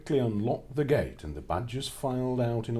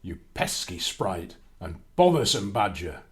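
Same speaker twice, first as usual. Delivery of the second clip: loud menacing giant threat; shouting giant; 8 dB louder than usual. Acted. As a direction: bellow it after them. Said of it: "he was shouting".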